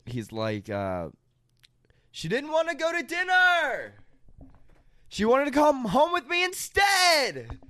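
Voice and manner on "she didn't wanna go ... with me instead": high, shouted bragging bro voice; mock bro shout. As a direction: brag it out like a dude. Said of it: "saying a negative thing and then shouting".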